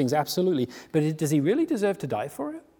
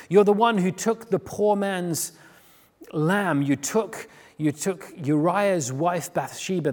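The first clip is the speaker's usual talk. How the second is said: confronting rebuke; 4 semitones higher, 7 dB louder than usual. Acted. Prophet confronts the king.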